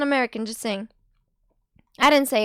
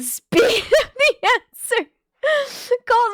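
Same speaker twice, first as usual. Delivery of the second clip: laughing; 8 semitones higher, 4 dB louder, slower than usual. Real, not acted. Laughing at quick answer.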